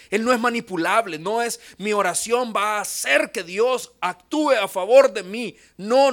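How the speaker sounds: loud, emphatic pulpit delivery; forceful preaching. Acted. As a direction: drive each point home to the church. (predicación enérgica)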